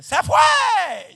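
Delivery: put-on high, loud cry of the old woman; relieved shout. Old woman crying out when the light comes on, loud shout. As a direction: shriek with joy that it's gone.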